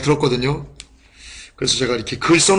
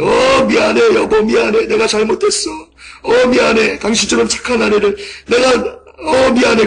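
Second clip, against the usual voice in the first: tearful apology; 7 semitones higher than usual. Retold or imitated. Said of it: "대성통곡을 하던데".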